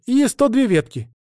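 reporting novice. A narrator's reading. Novice finishes listing the oak damage, reporting. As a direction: add the last item emphatically. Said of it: "отчиталась послушница".

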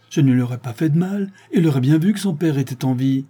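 high, pleading voice of an accused man defending himself; protesting accused. Acted. Accused Séraphin defends himself in court. (accusé qui proteste, voix aiguë et pressante)

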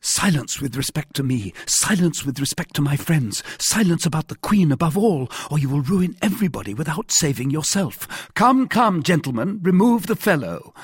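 high, urgent commands; stern silencing. A narrator's reading. D'Artagnan silences the mercer before guards, urgently commanding. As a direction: build to a loud, high order.

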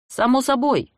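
high, loud call from another room; bright shout from the next room; shouted from the kitchen. A narrator's reading. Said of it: "крикнула я из кухни".

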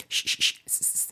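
voiceless drill, hushing SH then thin S; short repeated consonant bursts; alternating sh and s. Shown as a demonstration. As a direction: switch cleanly from 'sh' to 's'. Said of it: "este es un truco, practicar".

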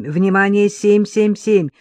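slow, loud alarm call over headset; urgent radio alert, slow and loud; radio dispatcher voice. Acted. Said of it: "раздался в наушниках голос диспетчера".

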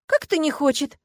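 high-pitched, incredulous question; surprised. A narrator's reading. Agata hearing someone does not want marriage, astonished. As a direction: question it in disbelief. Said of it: "удивилась девушка".

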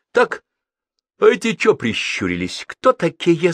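loud, demanding challenge; stern questioning. A narrator's reading. Crazed man interrogating the foreign passengers, stern. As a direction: challenge them sternly, voice rising. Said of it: "строго спросил Бабст".

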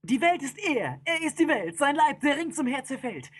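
high, driving storytelling; wild, high-pitched epic recital; frenzied narration. A narrator's reading. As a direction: race on breathlessly like a stampede.

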